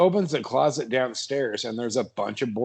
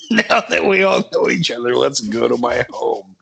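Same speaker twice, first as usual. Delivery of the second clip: louder star impression; Kevin Costner; 6 dB louder than usual. Shown as a demonstration. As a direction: echo the line as a hammed-up Costner. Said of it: "I'm Kevin Costner from movies".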